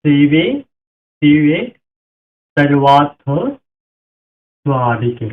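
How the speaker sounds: শিবে সর্বার্থ স্বাধিকে recited loud and deliberate; Sanskrit pronunciation. Shown as a demonstration. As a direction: declaim শিবে শিবে for the class. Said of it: "সারেওয়া".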